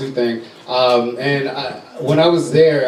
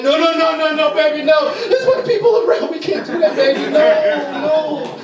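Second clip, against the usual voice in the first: panicked dad; 13 semitones higher than usual. Retold or imitated. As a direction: hush the kid in a panicked rush. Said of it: "And I was like".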